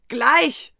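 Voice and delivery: lauter gereizter Schrei. A narrator's reading. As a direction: genervt losschreien. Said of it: "schrie ich". (wütend geschrien)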